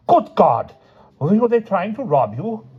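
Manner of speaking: high, startled gasp of alarm; aghast at the cut lip; shocked Crabbin. A narrator's reading. Crabbin is horrified that someone hit Dexter, high and shocked.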